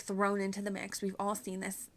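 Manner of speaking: normal talking voice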